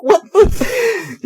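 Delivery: can't believe what he's watching; incredulous. Real, not acted. Disbelief at the cartoon's stupidity.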